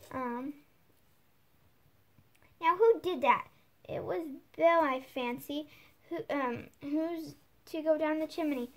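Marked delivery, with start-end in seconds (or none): none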